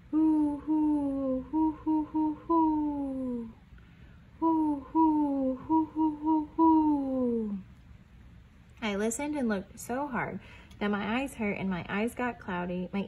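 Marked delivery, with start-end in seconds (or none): 0.1-7.6 higher, louder hooting owl call. Acted. Pa calling again into the dark trees, hooting. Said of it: "And then Pa called".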